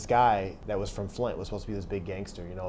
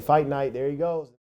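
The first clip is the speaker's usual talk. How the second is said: imitating a teasing inmate, a bit higher and louder; mocking praise; 6 semitones higher, 6 dB louder, slower than usual. A teasing inmate hailing him after the fight, mock praise. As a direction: lightly hype him up like a taunting fan.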